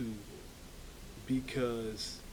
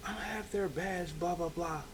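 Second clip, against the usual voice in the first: outraged mom; 6 semitones higher, 5 dB quieter than usual. Retold or imitated. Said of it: "His mom is outraged".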